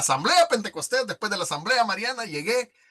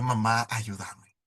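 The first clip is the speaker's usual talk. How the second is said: tearful plea; 8 semitones lower, slower than usual. Retold or imitated.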